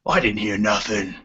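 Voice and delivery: lightly voiced dwarf; a hint of a different character's voice; second dwarf's voice. Dwarf further down the well answers, lightly voiced character. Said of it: "another voice, bel-, uh, a little bit further down, says".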